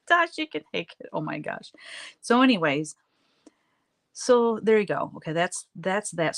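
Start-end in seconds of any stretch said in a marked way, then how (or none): 0.1-1.1 high, sing-song imitation of the clerk; sweet clerk. Mimicking the clerk telling Tasha to keep it, high and sweet. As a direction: pitch it way up like a kindly shop clerk.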